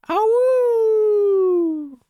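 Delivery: high howling cry; wolf howl. Pretend. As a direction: throw your head back and howl. Playful howl after wolf line.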